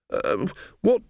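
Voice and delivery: high, slow, helpless wail; panicky wail. A narrator's reading. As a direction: wail for help, out of ideas. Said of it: "she wailed".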